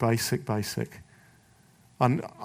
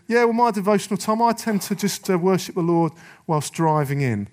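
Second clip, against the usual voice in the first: higher, louder put-on justifying voice; mock excuse-making; 6 semitones higher, 4 dB louder than usual. Preacher mimicking believers who worship whilst driving, mock excuse-making. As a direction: make a lame excuse, pitched up in mockery.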